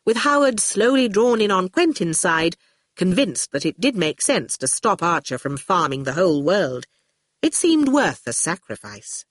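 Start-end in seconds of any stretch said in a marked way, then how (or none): none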